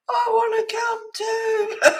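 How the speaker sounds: very high, slow whimpering plea; high, slow, crying cat's plea. Retold or imitated. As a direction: voice the cat begging to come along. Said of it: "he's at the front door".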